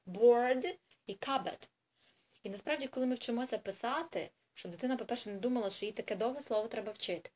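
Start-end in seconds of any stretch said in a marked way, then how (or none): 0.1-1.7 Board і cupboard, each word drawn out slowly; slow and stressed. Shown as a demonstration. Teacher shows the two words to a child, slowly. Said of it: "Для неї це два різних слова".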